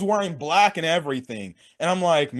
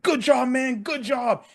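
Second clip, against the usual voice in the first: higher, cheery mock congratulations; John Cena, happy; 4 semitones higher, slower than usual. Happy wrestler congratulating his ally, higher and cheerful. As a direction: imitate someone happily congratulating a friend. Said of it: "Cena's happy".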